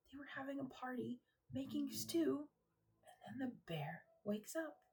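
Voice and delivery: hushed, breathy whisper. Real, not acted. Teacher recapping story to kids, whispering the twist. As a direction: lean in and whisper it. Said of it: "whispered retelling".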